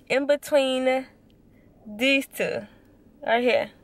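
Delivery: talking with finger in mouth; finger pulling lip down. Real, not acted. Seen 1: Finger holds lower lip down, showing braces, through all labelled words.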